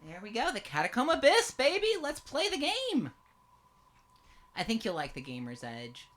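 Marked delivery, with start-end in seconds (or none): 0.1-3.1 excited. Real, not acted. Hyping up the game start.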